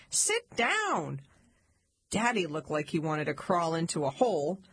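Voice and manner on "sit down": high-pitched snap, not shouted; mom's sharp command, lightly acted; snapped order. A narrator's reading. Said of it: "and snapped".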